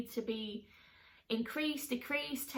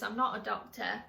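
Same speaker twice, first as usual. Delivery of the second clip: ordinary talk, nothing marked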